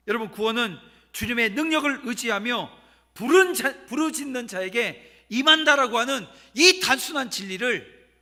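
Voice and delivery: loud, emphatic sermon delivery with stretched key words; full-force preaching. Real, not acted. Preacher blesses the congregation to become disciples, preaching forcefully. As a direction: drive each phrase home to the congregation.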